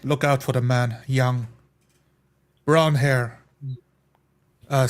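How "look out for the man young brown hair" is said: ordinary talk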